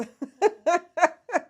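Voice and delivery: loud high laugh; loud delighted laughter; big laugh. Real, not acted. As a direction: burst out laughing at the comment. Laughing at comment.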